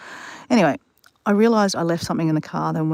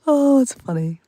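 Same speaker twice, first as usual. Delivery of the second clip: high voice, just out of laughter; laughing; 7 semitones higher, 3 dB louder, slower than usual. Real, not acted. Amused by the chat, high laughing aftermath. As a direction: say it recovering from a big laugh.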